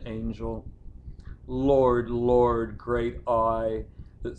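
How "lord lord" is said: loud lament. Acted. Lamenting cry in a poem.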